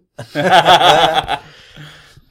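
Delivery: big loud laugh. Real, not acted. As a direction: burst out laughing. Laughs at the fan fiction joke.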